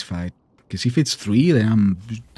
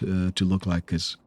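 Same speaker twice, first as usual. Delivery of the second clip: ordinary delivery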